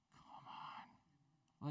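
breathy whispered plea. Real, not acted. Pastor urging the congregation to see it, whispered. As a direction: whisper it urgently.

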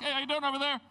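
put-on carefree flying kid; cheery hello. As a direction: wave to the neighbor like nothing's wrong. Waving to neighbor mid-flight.

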